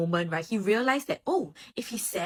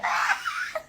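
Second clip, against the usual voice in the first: mock wail. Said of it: "dramatically burst into tears".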